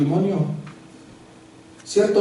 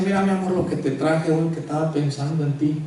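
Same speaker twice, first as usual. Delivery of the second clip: softer, sweet-talking his wife; tender husband; 6 dB quieter than usual. Acted. Husband bringing his wife chocolates, soft and sweet. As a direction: coax her gently with the gift. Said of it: "uno viene y le dice".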